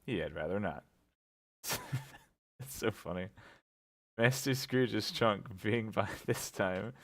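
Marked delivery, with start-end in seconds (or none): 1.6-6.9 real laughter breaking through, quiet and slowed; reading while trying not to laugh. Real, not acted. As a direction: giggle your way through the words. Reader cracks up mid-reading.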